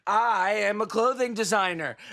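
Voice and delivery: usual speaking voice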